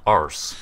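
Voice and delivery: "Arse" with a long open ah, British style; British pronunciation. Shown as a demonstration. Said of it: "That is the correct pronunciation".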